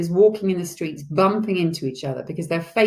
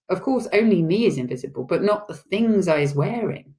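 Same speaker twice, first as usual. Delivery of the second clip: plain reading voice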